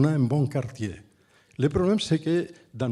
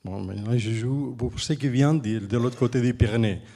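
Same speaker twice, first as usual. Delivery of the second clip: French with a Catalan speaker's accent; non-native French, from across the Pyrenees; foreign accent in French. Real, not acted. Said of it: "excusez-moi mon français".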